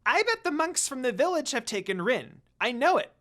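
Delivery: high, loud little creature; Jakin voice. Acted. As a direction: chatter eagerly like a small imp.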